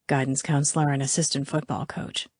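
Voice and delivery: low, soft murmured aside; whisper. A narrator's reading. Garrett fills Callie in on Dorfman, murmuring low. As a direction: keep it quiet and close. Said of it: "Garrett whispers again".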